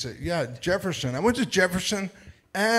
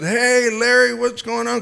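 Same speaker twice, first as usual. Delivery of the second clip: excited greeting; 6 semitones higher than usual. Retold or imitated. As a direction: call out cheerfully. Greeting friend by wrong name.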